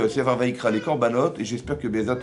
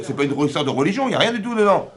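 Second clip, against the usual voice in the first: indignant protest; 5 semitones higher, 4 dB louder than usual. Pretend. Voicing the protesting wife.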